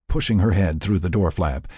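usual speaking voice

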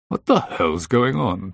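high, loud, drawn-out demand; alarmed demand. A narrator's reading. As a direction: demand an explanation, stretching the words. Partner arrives, alarmed by noise.

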